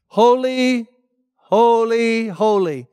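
drawn-out calling out, stepping down in pitch; imitated seraphim cry. Seraphim flying around the throne, imitated calling out. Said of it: "they're shouting out".

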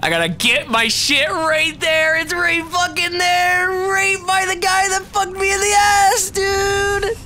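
high, strained yelling at the game; frantic high yell. Real, not acted. Gamer frantic to get his stuff back, high yell.

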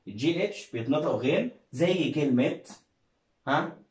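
normal talking voice

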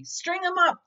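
bay for his punishment; men of the town calling for his punishment; angry mob. A narrator's reading. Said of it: "the men of the town said".

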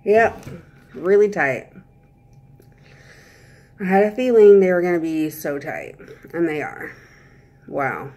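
slight lisp from aligners; talking with aligners in. Real, not acted. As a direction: teeth covered by trays. Seen 2: Fitted just before at -7 to -1; points at teeth during.

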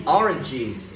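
ORANGES drawn out with a voiced j then z ending; plural final z. Shown as a demonstration. Teacher models the plural for students, ORANGES ending in z. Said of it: "phải có âm giờ phía cuối".

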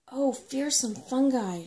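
loud, slow, high startled cry; sudden exclamation. Acted. Brownie remembering her provisions, loud startled exclamation. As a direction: exclaim it as if struck by a sudden thought.

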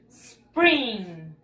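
SPRING starting on a long hissed S, no vowel before it; pure initial S. Shown as a demonstration. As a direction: stretch the S of "spring", "sss-pring". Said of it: "solamente la S". (solo la S inicial, alargada)

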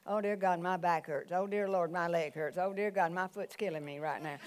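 low, quiet running grumble of aches; muttered complaint-prayer. Retold or imitated. Preacher mimicking worshipper muttering through the service, low complaint-prayer. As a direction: mimic someone praying under their breath. Imitates someone praying about aches all along.

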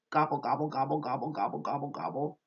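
a gentle imitation of a turkey's gobble. Teacher lightly imitates a turkey for children. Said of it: "Which one says".

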